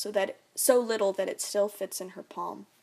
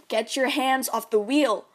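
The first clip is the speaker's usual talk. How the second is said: higher, louder stern order; demanding; 4 semitones higher, 6 dB louder than usual. Acted. Dad orders mom off the wheel, demanding. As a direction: bark the order at her. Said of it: "my dad demands".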